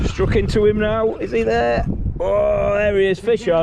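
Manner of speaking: rising, thrilled hook-set call; sudden buzz of a fish on; excited. Real, not acted. Fish strikes, hooked it.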